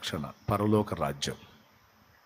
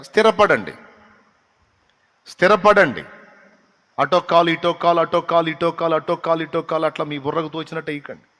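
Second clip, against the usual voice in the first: louder, higher urging from the pulpit; forceful exhortation; 4 semitones higher, 6 dB louder, faster than usual. Acted. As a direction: press the church to settle down. (గట్టిగా హెచ్చరించే ప్రసంగం)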